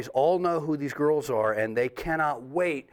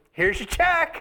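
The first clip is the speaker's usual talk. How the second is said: high, bright-sounding mock clerk voice; lightly voiced clerk, pitched high; cheery clerk; 14 semitones higher, 3 dB louder, slower than usual. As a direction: hand it over sweetly. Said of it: "she goes".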